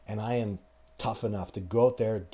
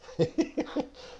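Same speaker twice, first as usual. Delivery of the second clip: airy, very high giggle at the dog. Real, not acted. Host laughing at his dog, high breathy giggle.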